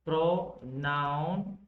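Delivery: "Pronoun" stretched into pro... noun; teacher sounding out "Pronoun" in two parts; slow syllable split. Shown as a demonstration. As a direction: draw out both halves of "Pronoun". Breaks the term into parts.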